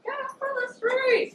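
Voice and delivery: high, slow drawn-out yell; mock furious yell at the man in the street; retold shout. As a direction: bellow it out slowly, exasperated. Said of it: "I'm like".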